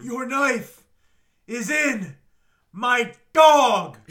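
slow, loud, building to a yell; dramatic shout. Retold or imitated. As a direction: pause then yell the last word. The line he waited for.

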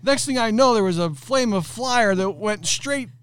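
high, excited account, half laughing; frantic retelling. Retold or imitated. Employee explaining to boss, high frantic telling. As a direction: rattle it off in a high rush. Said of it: "He's like".

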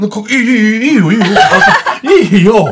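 delighted. Real, not acted. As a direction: happily welcome the request with a laugh. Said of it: "だからそんなに喜ばなくて大丈夫よ".

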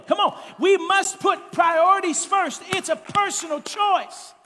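shouted, high-pitched preacher climax; rising into a roaring sermon climax; preaching at full force. Acted. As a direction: build to a fiery pulpit shout.

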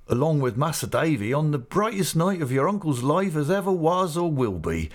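high, excited rustic voice, running on in delight; Mister Peggotty, greatly animated. A narrator's reading. Uncle calling his niece to meet the guests, high and animated. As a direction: gush with pleasure in country dialect. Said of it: "speech all in a breath and with extraordinary animation".

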